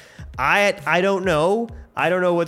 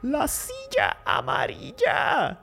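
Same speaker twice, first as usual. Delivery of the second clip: high, hammed-up squeal of excitement; excited fanboy cry; 5 semitones higher than usual. Fan exclaiming over Professor X's yellow chair, excited. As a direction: cry out, overcome with fan glee.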